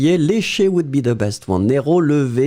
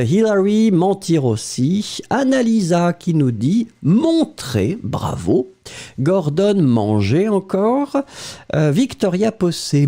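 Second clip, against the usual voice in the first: ordinary delivery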